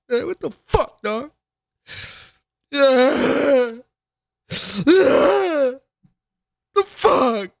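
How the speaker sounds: high, whiny imitation of a man sobbing and whimpering; mock sobbing. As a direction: ham up a grown man crying over money. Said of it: "Crying, whimpering like a fucking bitch".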